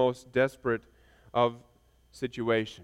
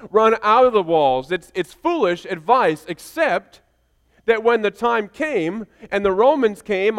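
higher, urgent pulpit storytelling; impassioned preaching; 7 semitones higher, 7 dB louder than usual. Real, not acted. Preacher telling congregation of the flight from Jerusalem, impassioned preaching. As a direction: preach the story with driving emphasis.

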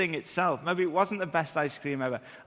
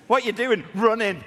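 higher and louder bright retelling; playful mock question; 5 semitones higher, 7 dB louder than usual. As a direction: toss it out like a playful kid. Playful kid running exchange.

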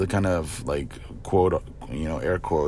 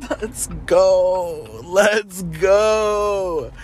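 high, drawn-out hype cry; celebratory cheer; 13 semitones higher, 4 dB louder, much slower than usual. Real, not acted. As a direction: stretch it out in a high, loud whoop.

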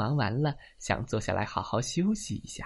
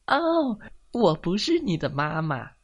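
high "oh", then higher-pitched protest; startled denial; 6 semitones higher, 3 dB louder than usual. Acted. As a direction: react with surprise as the hen. Hen startled at being called mama.

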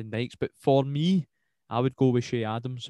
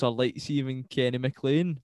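normal talking voice

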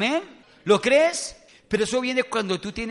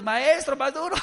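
onlooking disciples; 7 semitones higher, 3 dB louder than usual. Acted. Mimics disciples calling to floundering man.

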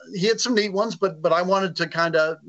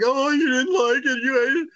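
mock dismay; 10 semitones higher than usual. Author fears partner hated book.

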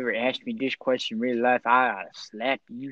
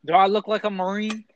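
pitched-up, offended challenge; indignant retort; 8 semitones higher, 4 dB louder than usual. Acted. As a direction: throw the question back, insulted. Indignant redneck character's rebuttal.

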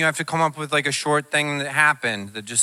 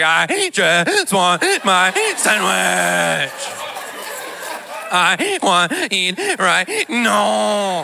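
slow, hyperventilating mock sobs, higher pitch; mock bawling; 5 semitones higher, much slower than usual. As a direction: blubber it out like a wrecked, gasping kid. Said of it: "started bawling over my sandwich".

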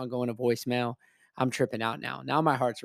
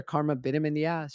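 ordinary delivery

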